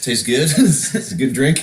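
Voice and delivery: laughing. Real, not acted. Drinking on air, joking.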